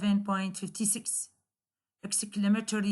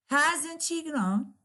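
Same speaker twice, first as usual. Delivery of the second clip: slow "Hasn't she grown?" with Hasn't stressed and a falling end; exclamatory falling tune; 4 semitones higher, much slower than usual. Shown as a demonstration. Teacher repeats the exclamatory example, falling tune. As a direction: stretch it out and let it fall. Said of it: "there will be a falling instead of rising intonation".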